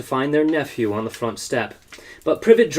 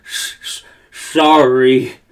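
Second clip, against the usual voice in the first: sobbing; 6 dB louder, much slower than usual. Acted. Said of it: "sobbed Hagrid".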